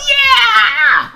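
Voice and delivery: high whooping yeah; a real burst of excitement; excited cheer. Real, not acted. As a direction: cheer it like a celebration. Celebrating hundredth strip.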